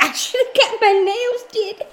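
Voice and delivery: high, slow mock whine; hammed-up high voice quoting her. Friend quoting her in a high mocking whine. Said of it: "She's like".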